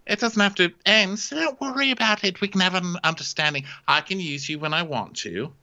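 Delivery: high, airy princess making her pitch; mock coaxing princess. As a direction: lightly play a princess smoothing over a proposal. Voicing princess propositioning her knight.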